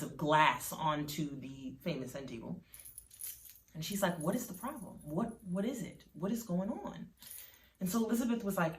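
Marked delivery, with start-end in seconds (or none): 4.2-7.1 soft, higher, halting questions; gently urgent, pitched up; worried questioning. A narrator's reading. Mother asking sobbing daughter what is wrong, soft and worried.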